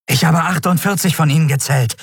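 high-pitched, breathless scout; small winged scout panting out a report. A narrator's reading. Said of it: "berichtete der Yadi atemlos".

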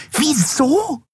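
very high, incredulous screech; shrill repeat. A narrator's reading. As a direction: echo it back in shrill disbelief. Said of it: "wiederholte die Yadi schrill". (schrill und ungläubig wiederholt)

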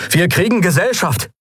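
high-pitched lookout's call; called-out warning. A narrator's reading. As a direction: call a warning across the bridge. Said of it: "rief Kai von seiner Stellung".

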